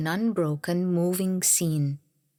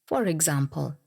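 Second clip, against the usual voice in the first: ordinary talk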